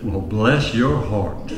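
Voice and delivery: mild Southern lady, sweetly slow; Southern drawl. Acted. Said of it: "soft-spoken woman from the South".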